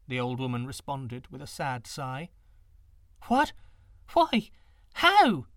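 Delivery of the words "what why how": high, loud alarmed cries; frantic girl firing questions; panicked questions. A narrator's reading. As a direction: blurt each question in rising panic.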